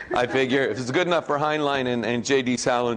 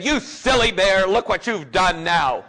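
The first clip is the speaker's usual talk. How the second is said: higher, slow scolding Rabbit voice; Rabbit scolding Pooh; 7 semitones higher, 4 dB louder than usual. Retold or imitated. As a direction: scold the bear in character. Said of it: "stayed in character".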